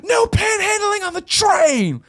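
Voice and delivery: very high, drawn-out mock shout; imitated loud yell. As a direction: imitate a man bellowing across a train car, slowly. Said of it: "as loud as possible".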